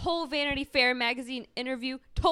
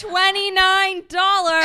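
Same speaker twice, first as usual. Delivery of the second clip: slow, loud, higher interjection; yelling; 7 semitones higher, 10 dB louder, slower than usual. Acted. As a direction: bellow the price over her. Mom yells over the voicemail.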